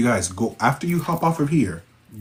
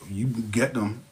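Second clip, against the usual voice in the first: ordinary talk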